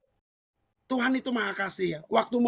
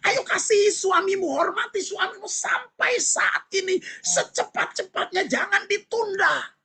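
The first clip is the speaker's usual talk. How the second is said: raised, urgent preacher's call to the congregation; preaching at full force; 8 semitones higher than usual. Acted. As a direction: exhort the flock, driving each line high.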